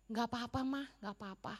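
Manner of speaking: soft, hushed forgiving reply; gentle forgiveness. Retold or imitated. Preacher replies to mother-in-law's apology, soft and forgiving. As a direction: forgive her quietly and kindly. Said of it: "Sorry ya".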